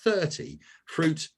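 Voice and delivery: thirty drawn out slowly and stressed; slow, deliberate "thirty" for emphasis. Shown as a demonstration. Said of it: "I repeat".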